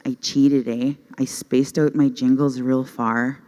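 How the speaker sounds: usual speaking voice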